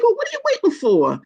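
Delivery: high, exasperated demand. Retold or imitated. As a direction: push him, fed up. Exasperated quote to the doctor.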